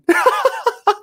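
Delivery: high laugh that breaks out mid-story; sudden high-pitched laugh; burst of laughter. Real, not acted. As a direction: laugh out loud at the cabin reveal. Reacting to the abandoned cabin.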